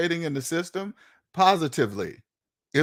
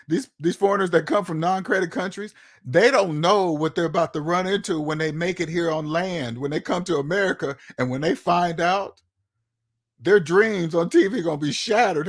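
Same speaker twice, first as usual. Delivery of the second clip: ordinary delivery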